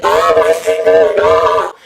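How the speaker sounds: loud panicked cry of pain; freaking out. Retold or imitated. Teen shouting after slamming finger in door. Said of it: "Freaking out, crying".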